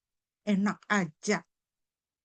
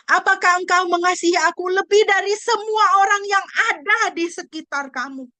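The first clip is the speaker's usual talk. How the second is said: rising, louder preacher's plea; preaching at full force; 8 semitones higher, 5 dB louder than usual. Acted. Preacher pressing Jesus' question on the church, raised voice.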